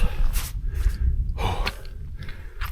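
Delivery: long winded exhale; puffing hard after a climb; heavy panting breath. Real, not acted. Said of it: "sorry about the huffing and puffing".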